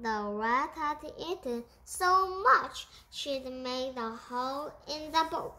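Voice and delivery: ordinary delivery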